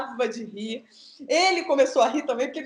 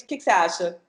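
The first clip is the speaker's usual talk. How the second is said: ordinary talk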